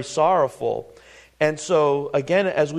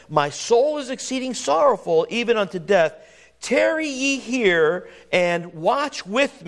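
scripture reading; 4 semitones higher than usual. Acted. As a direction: read the old verses with weight. Reading King James scripture aloud.

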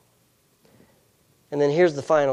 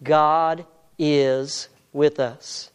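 ordinary talk

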